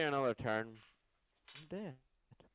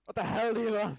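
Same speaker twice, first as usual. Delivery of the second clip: high, loud shout back; 12 semitones higher, 6 dB louder than usual. Acted. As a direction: yell an answer from far off. Answering a call in fog.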